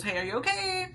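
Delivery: high imitated check-in; concerned mom. Mom knocking at shower door, acted high concerned call. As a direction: call gently, high-pitched, as a worried mother.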